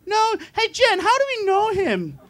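a light high mock of the girl at the stall; imitating a woman. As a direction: squeal it like a puzzled young woman. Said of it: "She goes".

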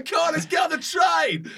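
high, loud rallying yell; mad excited shout. Retold or imitated. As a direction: imitate a wild guy on a table whipping up the crowd. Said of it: "He was absolutely mad".

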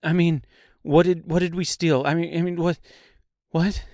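high, flustered, tripping over repeated words; stammering. A narrator's reading. Dorothy asking the tree what they stole, stammering. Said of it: "Dorothy stammered".

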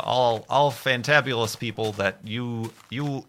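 ordinary talk, nothing marked

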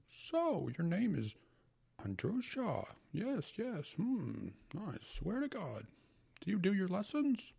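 put-on high, hearty old uncle. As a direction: play the fussy old uncle. Uncle's voice in story.

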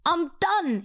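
high-pitched, mock-despairing meme voice; TikTok meme quote. Quoting a TikTok meme to a friend for a joke, mock despair. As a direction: wail it jokingly, as if giving up on love. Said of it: "that TikTok meme that I always say".

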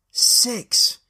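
called-out count. Retold or imitated.

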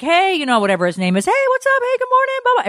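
mock greetings pitched high and bright; cheerful greeting. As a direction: greet him like a happy coworker. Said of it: "is like".

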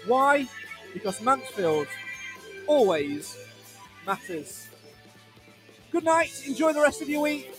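big, high radio sign-off voice; announcer outro. Acted. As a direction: sell the sign-off like a trailer. Show outro over music.

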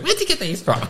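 high, soft mock mum; mum's voice. Mum asks her kid about the sweets, high-pitched questioning. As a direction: put on a high, quiet voice for mum.

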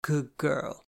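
lightly voiced teasing through pursed lips; a sly mock-praise tease. A narrator's reading. Teasing her sister about her new girl, lips pursed. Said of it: "she teased through pursed lips".

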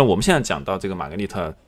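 normal talking voice

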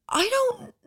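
very high, breaking off; flustered high squeak; panicked stammer. Retold or imitated. Answering neighbours, high and cut off.

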